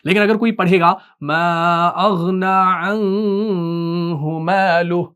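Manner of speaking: slow Quran recitation showing a minor slip; recited with a small error. Shown as a demonstration. Teacher demonstrates a faulty recitation to students, slow and measured. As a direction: recite it slowly, with the small mistake left in. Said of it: "तो ये भी गलती है".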